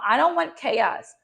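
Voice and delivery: normal talking voice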